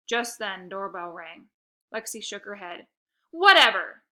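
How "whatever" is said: ordinary delivery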